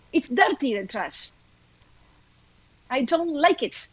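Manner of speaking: high-pitched mock nagging Cholita; hammed-up accented telling-off; Cholita scolding husband. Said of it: "you can yell at me".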